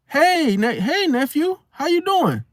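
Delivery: high-pitched mock auntie hello; bold auntie greeting. Aunt walks out to greet her nephew, bold and high-pitched. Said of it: "They'll walk out there boldly and be like".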